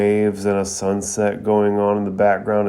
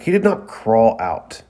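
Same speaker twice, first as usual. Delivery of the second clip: indignant raised-voice denial; yelling back; 4 dB louder than usual. A narrator's reading. Lee defending his sensei to Naruto, mildly yelled retort. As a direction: protest hotly, short of a full shout. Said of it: "Lee yells back".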